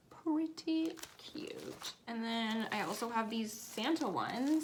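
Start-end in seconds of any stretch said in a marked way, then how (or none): 0.1-1.9 whispering. Shown as a demonstration. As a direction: breathe it in a whisper. Whispered repeat of phrase.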